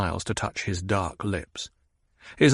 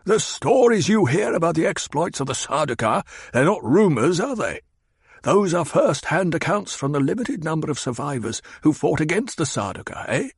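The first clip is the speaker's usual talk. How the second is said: higher, needling questions; Hawat, challenging; 7 semitones higher than usual. Acted. Hawat needles the Baron about the Sardaukar, higher. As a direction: push him to admit it.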